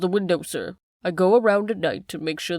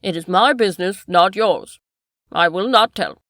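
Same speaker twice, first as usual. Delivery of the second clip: louder, firm refusal; defiant; 9 dB louder than usual. Acted. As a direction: stand your ground. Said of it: "openly defiant".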